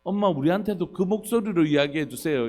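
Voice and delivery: imitated pleading children. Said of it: "아이들이".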